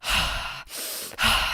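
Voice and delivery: long audible exhale; deep breath out. Retold or imitated. Mom showing her deep breaths, long exhale. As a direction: breathe out hard to calm down.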